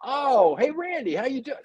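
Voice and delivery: high-pitched mock-innocent greeting; Joe, feigned innocent surprise. Quarterback greeting the defender who floored him, mock-innocent surprise. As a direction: act casually surprised to bump into someone, voice lifted high. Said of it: "And he'd go".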